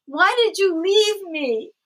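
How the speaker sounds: put-on high, slow plaintive wail of a child; upset child. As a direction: imitate a wounded kid, drawn out and high. Upset child confronting mother, retold.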